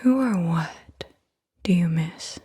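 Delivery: ordinary talk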